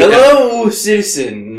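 in-character greeting. Acted. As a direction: start high, then drop as you stretch it.

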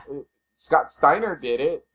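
ordinary talk, nothing marked

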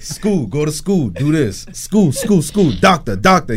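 imitating a strict Nigerian father; lightly voiced Nigerian accent; Nigerian dad accent. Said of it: "They got the thick Nigerian accent too?".

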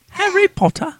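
high English voice; English accent. Pretend. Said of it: "English accent".

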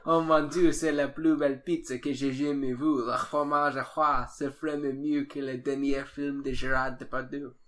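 hammed-up French chef voice; mock gushing French chef. Hammed-up French rant after jokes.

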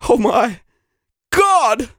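awestruck cry climbing high; overjoyed. Acted. Hungry applicant spotting the snacks, overjoyed. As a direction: gasp it out, then yell with delight.

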